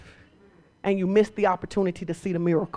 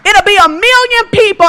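climbing, much higher and louder; preaching at full force; 11 semitones higher, 12 dB louder than usual. Real, not acted. Preacher building the crowd up, much higher and louder. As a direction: lift the crowd with it.

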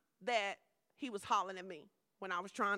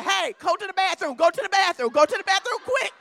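higher, much louder, urgent repeated orders; urgent commands; 7 semitones higher, 14 dB louder than usual. Retold or imitated. The Lord loudly ordering the pastor to the bathroom. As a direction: bark it out like a loud command. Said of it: "The Lord was like".

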